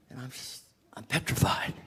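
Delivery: whispered admission; whispered fear. Retold or imitated. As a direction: breathe it out in a whisper. Recalling fear at the door.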